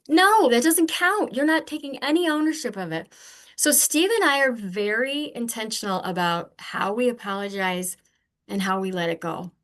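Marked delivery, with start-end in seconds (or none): 0.1-3.1 higher, louder indignant no; indignant protest. Retold or imitated. Rejecting a fake apology, loud indignant protest. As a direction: push back hard, it doesn't count. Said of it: "I'm like".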